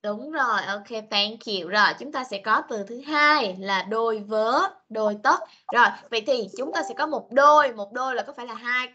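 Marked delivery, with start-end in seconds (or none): none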